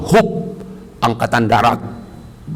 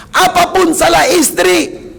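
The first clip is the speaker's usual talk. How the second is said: earnest plea; slower than usual. Real, not acted. Pleading to husbands in sermon.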